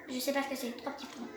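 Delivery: ordinary talk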